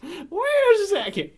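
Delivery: high, loud, drawn-out squawk; mock protest. Joking about the muddled plot, high drawn-out protest. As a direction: stretch it out slowly, high and loud.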